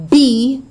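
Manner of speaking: BE high, loud and punched; stressed BE. Shown as a demonstration. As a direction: hit BE hard. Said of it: "is stressed".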